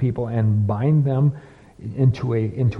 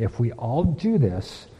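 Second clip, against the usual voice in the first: whisper. Real, not acted. Confiding aside to congregation.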